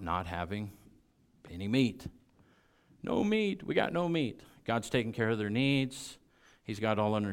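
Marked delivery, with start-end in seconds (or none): 3.0-4.4 high, whiny complaint about food; complaining crowd. Retold or imitated. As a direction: grumble like hungry people. People complaining about no meat.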